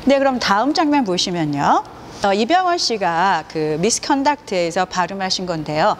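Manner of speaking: usual speaking voice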